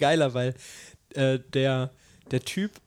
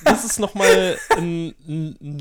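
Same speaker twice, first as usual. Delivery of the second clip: lachend; 6 semitones higher, 7 dB louder than usual. Real, not acted. Lacht über die dumme Folge.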